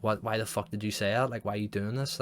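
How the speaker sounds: ordinary delivery, nothing marked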